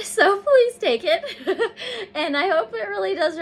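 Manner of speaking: tearful laughter; laughing through tears. Real, not acted. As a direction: giggle out the words, half crying.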